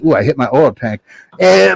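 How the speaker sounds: ordinary delivery, nothing marked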